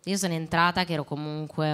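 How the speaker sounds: ordinary talk, nothing marked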